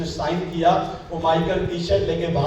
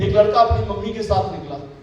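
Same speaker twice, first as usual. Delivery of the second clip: normal talking voice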